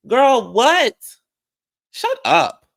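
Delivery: high, loud incredulous burst; indignant outburst. Real, not acted. Vlogger reacting to Dorit, loud high indignant outburst. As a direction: exclaim it loudly in disbelief.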